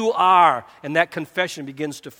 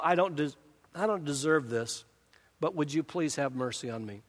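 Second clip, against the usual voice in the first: humble asking; 2 semitones lower, 7 dB quieter than usual. Retold or imitated. Sinner asking God for mercy, softly humble. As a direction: plead gently, not demanding. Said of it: "a tone that's asking".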